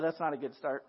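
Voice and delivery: normal talking voice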